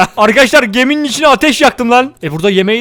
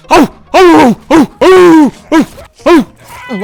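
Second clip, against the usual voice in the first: loud, high barking like a Kangal dog; mock dog barking; 6 semitones higher, 6 dB louder, much slower than usual. Gamer barking back at a taunting creature like a guard dog. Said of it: "Sivas Kangalı dürtülerimi harekete geçirecek".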